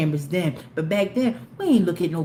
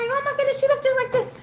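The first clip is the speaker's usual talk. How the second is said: much higher squeal of disbelief; shocked recognition; faster than usual. Retold or imitated. Mom seeing the lady's video, high shocked squeal. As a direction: gasp it out as it dawns on you.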